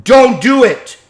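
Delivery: very loud, slow, high command; stern correction shout. Shown as a demonstration. Trainer correcting his dog with a loud stern shout. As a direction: bark the correction hard. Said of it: "And then".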